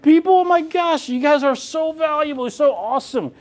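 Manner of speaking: high, gushing mock compliment; mock gushing praise. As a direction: gush over them like adoring fans. Imagined praise for realtors.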